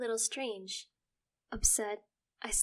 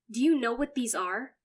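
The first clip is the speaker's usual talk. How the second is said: confrontational; 4 semitones higher, 9 dB louder than usual. Acted. Son confronts mother.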